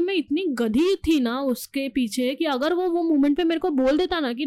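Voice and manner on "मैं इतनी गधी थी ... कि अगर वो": ordinary talk, nothing marked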